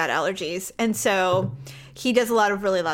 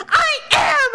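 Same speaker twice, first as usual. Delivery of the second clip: much higher, louder shouting back; 5 dB louder than usual. Retold or imitated. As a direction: yell back defensively. Husband yells back during argument.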